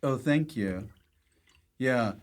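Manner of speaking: ordinary delivery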